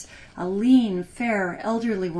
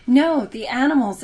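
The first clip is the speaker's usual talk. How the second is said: put-on loud, higher kid protest; crying out; 5 semitones higher, 8 dB louder than usual. Sister shouting down her brother's choice, loud protest. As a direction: jump in loudly over your brother. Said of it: "cried his sisters".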